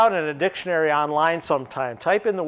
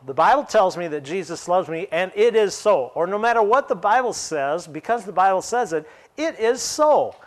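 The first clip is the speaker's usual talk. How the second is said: ordinary talk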